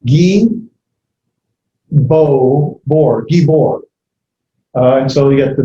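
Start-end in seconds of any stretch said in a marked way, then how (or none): 1.9-3.8 gi and bor split apart with pauses; syllable by syllable. Shown as a demonstration. Teacher breaking the word into syllables. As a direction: sound out gi, bor one syllable at a time. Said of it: "There's gi with the hard".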